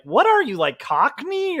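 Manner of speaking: high, loud mock bafflement; baffled and indignant, pitched right up; incredulous listener. British listener puzzled by a fake accent, incredulous. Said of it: "they're gonna be like".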